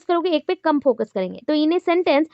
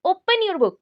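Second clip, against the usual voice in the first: higher command, hit "open"; stress on OPEN; 4 semitones higher, much slower than usual. Shown as a demonstration. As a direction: OPEN high and firm, falling away on "book". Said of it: "ओपन word को ज़्यादा focus दिया".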